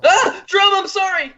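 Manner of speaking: very high character yelp; alarmed apology. Acted. Character hit a friend by accident.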